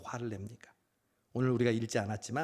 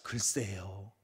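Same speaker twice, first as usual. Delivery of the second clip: cheeky reply; 6 semitones lower, 4 dB quieter, much slower than usual. Retold or imitated. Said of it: "이러면 더 화가 나잖아요".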